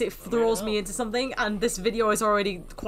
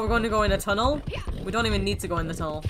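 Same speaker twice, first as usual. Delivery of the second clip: normal talking voice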